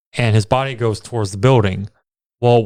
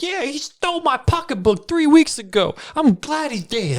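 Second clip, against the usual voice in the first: put-on high, shrill old woman; old lady voice. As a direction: gripe like an angry old lady. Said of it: "It's like".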